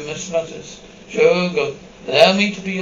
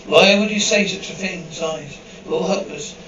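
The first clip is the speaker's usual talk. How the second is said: ordinary talk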